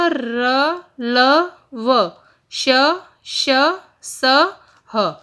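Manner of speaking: each consonant said crisply, a pause after each; reciting the consonants. Shown as a demonstration. Teacher showing students how each consonant sounds. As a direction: sound out क, ख, ग, घ one at a time. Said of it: "कौन कौन से?".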